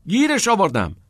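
high, loud cry of triumph; excited shout. A narrator's reading. Neil shouting from the hallway, triumphant. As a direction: shout the good news down a corridor.

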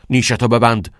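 loud, stern order to shut up; sharp command. A narrator's reading. Principal silencing a pupil, sharp and loud.

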